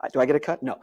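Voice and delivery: usual speaking voice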